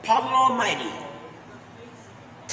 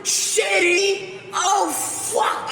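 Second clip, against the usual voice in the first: mock angry shout; 8 semitones higher, slower than usual. Viewer raging at the Adapted Mind video, shouting. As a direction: rage at the screen.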